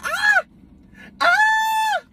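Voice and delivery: high, piercing yelp of pain; mock scream of pain. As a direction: howl like the whip really hurts. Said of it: "I started screaming".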